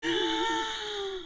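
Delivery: long, high, drawn-in gasp; shocked gasp. Real, not acted. Streamer reacts to Sukuna letting her in, astonished gasp.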